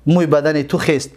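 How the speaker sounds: usual speaking voice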